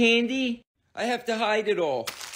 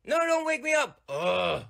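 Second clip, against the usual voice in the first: ordinary talk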